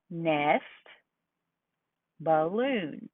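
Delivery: nest then balloon, each syllable dropped deliberately; syllables with chin drop. Shown as a demonstration. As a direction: say them slowly, feeling each syllable. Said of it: "every time their chin drops".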